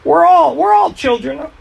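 high, loud protesting voice; mock protesting crowd. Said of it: "it sounds like they're saying".

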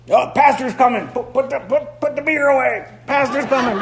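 high, hurried mock panic; panicked churchgoer. Churchgoer spotting the pastor, high mock panic. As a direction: hide the beer in a flustered hurry. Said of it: "That's integrity".

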